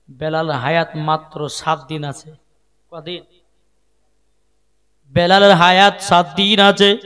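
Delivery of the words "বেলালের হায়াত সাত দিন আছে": loud, ringing sermon delivery; projected preaching. Acted. Preacher repeating Bilal has seven days left, loud preaching. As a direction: project it out to the whole congregation.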